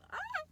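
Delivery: short, very high, loud squeal; freaked-out squeal. Retold or imitated. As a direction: let out a sudden high yelp, acting out the fright. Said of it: "I was, like, freaking out".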